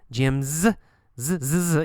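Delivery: "Jim's" with a buzzing final z; z sound stressed. Shown as a demonstration. Teacher drilling the needed sound, voiced "z". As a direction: isolate the "z" ending of "Jim's". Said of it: "you need the z sound".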